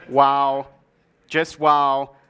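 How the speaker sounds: low, loud mock amazement; sarcastic. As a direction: feign being impressed, low and emphatic. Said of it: "I guess I should have hashtag sarcasm".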